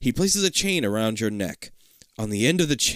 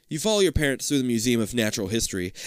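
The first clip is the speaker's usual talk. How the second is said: plain reading voice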